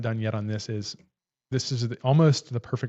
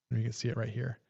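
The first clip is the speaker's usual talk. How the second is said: ordinary delivery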